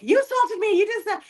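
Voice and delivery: high, loud confronting outburst; imagined accusation. Retold or imitated. Daughter confronting her abuser in front of mom, high loud outburst. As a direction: throw the accusation right at him. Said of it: "so I couldn't be like".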